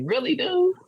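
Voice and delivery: high incredulous "really, dude?"; exasperated. Retold or imitated. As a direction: throw it up high in disbelief. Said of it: "I'm like".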